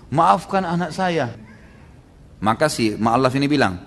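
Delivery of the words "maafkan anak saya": slow, higher-pitched apology; retold plea. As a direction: beg pardon slowly for your child. Mother begs the caliph's pardon.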